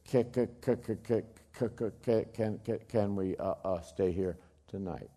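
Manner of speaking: quiet, stuttering through chattering teeth. Retold or imitated. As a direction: stammer like a freezing child. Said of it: "her little teeth are chattering".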